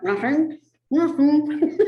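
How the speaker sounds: high, loud mumble breaking into laughter; giggly mock innocence; laughing numb-lip impression. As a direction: deny it through numb lips, cracking up. Mimics numb lips while laughing.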